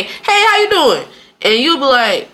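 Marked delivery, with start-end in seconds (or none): 0.0-1.0 high, drawn-out Southern hello; high-pitched, friendly Southern imitation; Southern greeting. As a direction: greet a stranger with a slow Southern lilt. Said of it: "in the South, people here will say".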